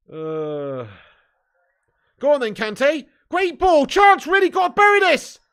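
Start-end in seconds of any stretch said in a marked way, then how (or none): 2.2-5.4 louder and higher with every word; excited shout. Real, not acted. Streamer watching the match, yelling at a chance, excited. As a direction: urge the striker on as the chance builds.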